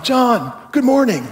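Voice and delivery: bright, high sing-song good morning; cheery greeting. Retold or imitated. Pastor greeting his grumpy neighbor, bright and high. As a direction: greet the neighbor as sunnily as you can. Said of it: "Being a nice neighbor".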